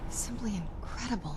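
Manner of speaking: hushed, breathy wonder on simply; soft whisper of amazement; awed whisper. Acted. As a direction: whisper it as if stunned. Awe at the Soul Cairn.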